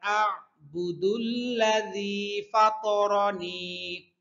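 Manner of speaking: slow melodic tajweed chant; measured Quranic cantillation; Quran recitation. Shown as a demonstration. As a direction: draw out each verse ending in song. Teacher chants verse, students repeat.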